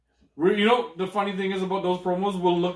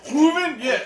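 very high, loud funk squeal; high, loud soul exclamations; 11 semitones higher, 6 dB louder, slower than usual. Acted. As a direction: belt it as a soul-singer yelp. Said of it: "I couldn't even keep up the voice".

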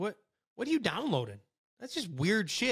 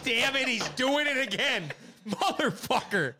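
raised-pitch fed-up cop, slowing into the curse; mock exasperation; 7 semitones higher than usual. Cop spots the same driver on the road, mock exasperation. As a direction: play an exasperated cop, half laughing. Acts cop spotting repeat offender.

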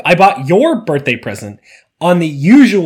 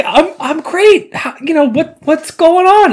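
awkward friendly reply; 11 semitones higher than usual. Retold or imitated. Man answering a stranger, high and hesitant. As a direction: play along politely, a bit lost. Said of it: "and I was like".

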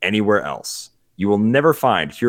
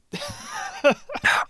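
a high wheezing laugh; high breathless laugh; 6 dB quieter than usual. Real, not acted. Host cracking up at co-host's Bing admission, wheezing laugh. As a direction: laugh silently, near squeal.

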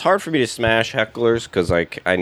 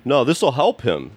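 heckler voice; 6 semitones higher, slower than usual. Retold or imitated.

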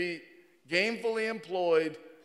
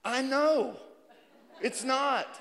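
cheerful agreement; 5 semitones higher, slower than usual. Retold or imitated. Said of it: "And you can say".

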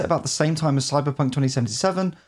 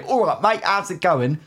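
put-on cheery overdone Cockney accent, a bit higher; a light parody of a Cockney greeting; mock Cockney; 5 semitones higher than usual. What you supposedly hear walking London, in mock Cockney. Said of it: "speak like that".

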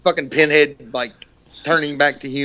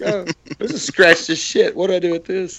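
puzzled complainer; 5 semitones higher than usual. Acted. Joking as the Cenobite's baffled victim, mock dismay. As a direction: grumble like someone baffled by a ruined disc. Jokingly voices person with scratched disc.

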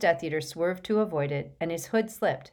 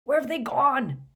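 bellowed; 8 semitones higher, 3 dB louder than usual. Acted. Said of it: "bellowed Hagrid".

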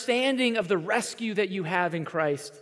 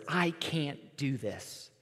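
slow, softer, mock-defeated child; discouraged child; 4 semitones lower, 8 dB quieter, much slower than usual. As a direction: drag it out like a little girl about to give up. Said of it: "there were the same words".

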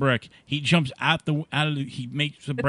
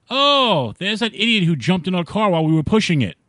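loud, high indignant yell; outraged shout; 5 semitones higher, 8 dB louder than usual. Retold or imitated. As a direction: bellow it like furious car owners. Men's outraged punchline in bar.

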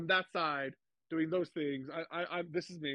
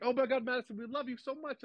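high, excited imitation of fans; mock fangirl adoration in a higher pitch; gushing fan; 9 semitones higher than usual. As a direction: gush like an adoring commenter. Retold gushing fan comments.

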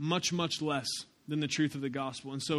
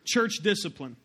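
ordinary delivery

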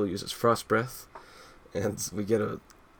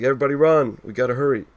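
urgent call; 4 semitones higher, 7 dB louder, faster than usual. Retold or imitated. Voicing Silver's urgent line.

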